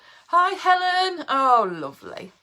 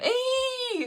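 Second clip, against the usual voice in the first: high held Fonzie squeal; 14 semitones higher than usual. Retold or imitated. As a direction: do the Fonzie Ee once more. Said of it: "I just sounded like Fonzie".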